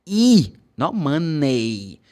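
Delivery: E drawn out slowly before money; slow teacher model. Shown as a demonstration. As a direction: stretch the ee sound. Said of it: "should be pronounced like this".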